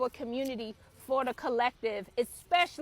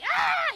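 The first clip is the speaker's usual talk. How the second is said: high, loud imitated boy's scream; happy child's scream; 7 dB louder than usual. Excited son screaming in his dad's ear, imitated happy shriek. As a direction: mimic a gleeful little boy screaming, high and loud. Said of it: "the son screamed in the dad's ear".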